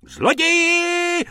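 very high, drawn-out scream; shrieking. Acted. Tobacconist woman spotting thieves, shrieking. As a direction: shriek the alarm across the street. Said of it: "rozječela se paní z protější trafiky".

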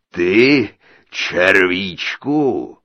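loud, drawn-out giant scorn; mocking giant. A narrator's reading. Giant mocks the tiny tailor, booming scorn. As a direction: boom it slowly with contempt.

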